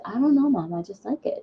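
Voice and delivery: small voice, at a loss to explain; kid self. Retold or imitated. Child answers mom's questions about the show, sheepish kid voice. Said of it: "me being the kid that I am".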